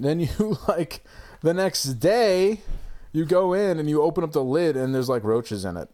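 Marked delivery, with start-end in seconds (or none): none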